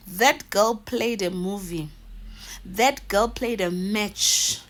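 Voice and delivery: slow drawn punchline; slow emphatic verdict. Real, not acted. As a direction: spell the punchline out slowly. Punchline delivered slowly.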